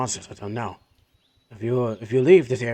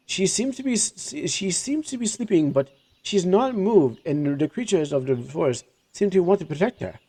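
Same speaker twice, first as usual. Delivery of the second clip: higher, hushed awe at the spirit; higher merchant voice; 6 semitones higher than usual. Acted. Jafar tells the party about the forest spirit, higher voice. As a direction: describe the sleeping spirit with wonder.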